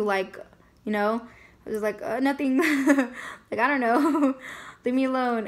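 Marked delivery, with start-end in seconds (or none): 2.0-5.5 higher-pitched, laughing evasion; giggly brush-off. Retold or imitated. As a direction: laugh nervously between short dodges. Brushing off her mother's questions, giggling.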